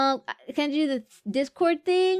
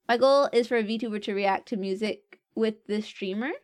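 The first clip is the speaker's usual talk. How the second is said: ordinary delivery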